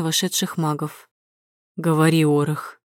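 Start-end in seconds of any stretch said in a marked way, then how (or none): 1.8-2.8 booming ruler. A narrator's reading. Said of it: "гремит Карден".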